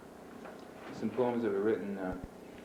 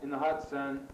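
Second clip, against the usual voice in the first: higher-pitched, measured recital than casual chat; poem reading; 5 semitones higher than usual. Acted. Poet reading a poem on a dying dog to listeners, measured recital. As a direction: deliver each image as its own phrase.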